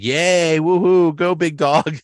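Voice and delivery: high, loud mock celebration; mock cheer. Reading cheerful card messages aloud, mock excited. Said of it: "all the messages were like".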